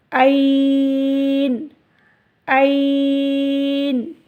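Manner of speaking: ऐन with a slightly longer vowel, stopped on न; stretched pause form. Shown as a demonstration. Teacher showing the pause, a bit longer. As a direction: lengthen ऐन a little. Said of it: "थोड़ा सा लंबा कर देंगे".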